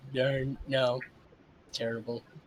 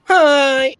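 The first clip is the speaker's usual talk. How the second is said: put-on anime girl voice; 9 semitones higher, 7 dB louder than usual. Parting anime girl bit.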